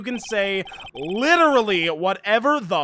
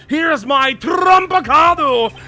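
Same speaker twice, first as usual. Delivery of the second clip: higher, louder, slow and drawn out; a slow, booming courtroom showstopper; triumphant shout; 7 semitones higher, 10 dB louder, slower than usual. Acted. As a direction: shout it like a winning card. Lawyer presents his evidence shout.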